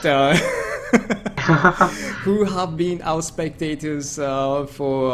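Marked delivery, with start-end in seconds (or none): 0.4-2.2 real laugh, pitched up; high, amused chuckling. Real, not acted. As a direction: burst out laughing at the name fumble. Amused by fumbling the name.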